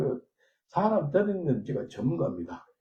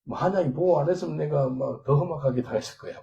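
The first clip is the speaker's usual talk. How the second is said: ordinary talk, nothing marked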